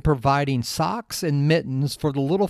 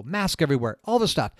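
normal talking voice

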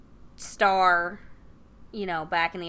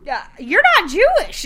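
higher and louder, an awkward blurt; blurted reason; 10 semitones higher, 10 dB louder than usual. Retold or imitated. Boyfriend tells Rose the real reason, blurted higher and louder.

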